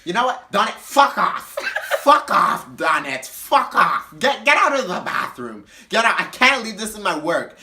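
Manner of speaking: loud, high mock rant; hammed-up drunken argument; cursing out. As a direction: hurl each curse as its own burst. Mary Jane curses at Donette.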